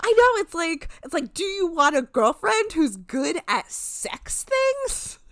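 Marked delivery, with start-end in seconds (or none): 1.4-5.2 mocking. Mocking the question.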